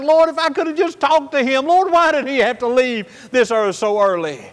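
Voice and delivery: a grieving son begging God to hear his father; tearful prayer. Retold or imitated. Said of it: "And I was crying".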